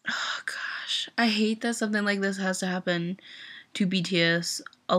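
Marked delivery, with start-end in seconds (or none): 0.1-1.1 soft unvoiced whisper, drawn out. Real, not acted. Fan upset about [name] news, whispering in dismay. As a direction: let it out as a tired whisper.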